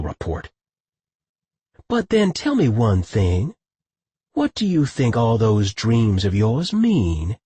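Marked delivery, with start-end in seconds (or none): 1.9-7.4 slow, innocent-sounding question; unhurried feigned innocence; perfect innocence. A narrator's reading. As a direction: ask it slowly, as if butter wouldn't melt. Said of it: "in a tone of perfect innocence".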